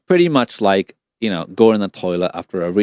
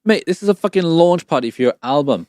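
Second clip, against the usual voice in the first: urging excitement; 8 semitones higher than usual. Real, not acted. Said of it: "sound so deflated all the time".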